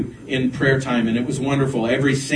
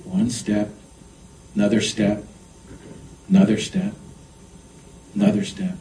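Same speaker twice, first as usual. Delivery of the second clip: step by step; 4 semitones lower, 5 dB quieter than usual. Real, not acted. Acts out stepping out gradually.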